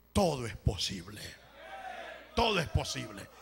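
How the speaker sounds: low, weighty declaration; grave, low preacher's promise; solemn assurance. Real, not acted. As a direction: drop the pitch and declare it with conviction.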